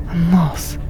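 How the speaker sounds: usual speaking voice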